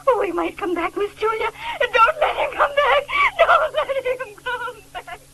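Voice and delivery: tearful, rising to a cry of don't let him come back; terrified pleading. Acted. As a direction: beg in panic, voice breaking. Maid pleading in terror.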